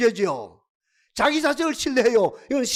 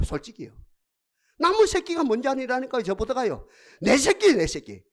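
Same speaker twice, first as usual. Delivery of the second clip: ordinary talk, nothing marked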